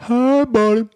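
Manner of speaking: very high doggy hello; squeaky baby-talk greeting; puppy-greeting voice. Acted. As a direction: coo at a grown-up like a puppy. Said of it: "trying to do it to just people".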